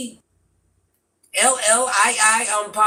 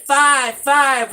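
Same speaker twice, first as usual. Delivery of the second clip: loud, punched repeat; loud insistent repeat; emphatic call; 4 semitones higher, 6 dB louder than usual. Real, not acted. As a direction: hammer the time home. Stressing the meeting time.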